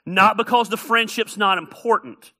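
ordinary delivery

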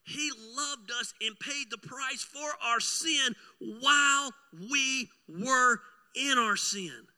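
sermon emphasis on grace; preaching. Acted. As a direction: lean into it, slow and heavy.

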